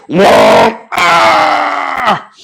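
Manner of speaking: high hammed-up yelps of a shocked man; mock scream of pain. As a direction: scream as if the jolt hits.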